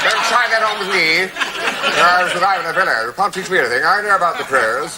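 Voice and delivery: high, laughing camp voice; a lightly voiced, theatrical old pro; camp old trouper.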